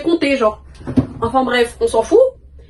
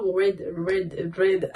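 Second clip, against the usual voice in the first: English accent; 3 semitones lower, 7 dB quieter, much slower than usual. Shown as a demonstration. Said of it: "mais avec l'accent anglais".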